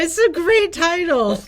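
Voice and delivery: laughing through the words, slow; amused laugh on a great title. Real, not acted. Host amused by the book title, laughing.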